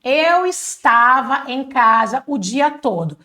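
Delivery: slow, fully spelled-out "estava"; deliberate teaching pace with every syllable of "estava"; long careful form. Shown as a demonstration. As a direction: draw out "Eu estava em casa" word by word. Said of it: "falando de uma forma mais comprida".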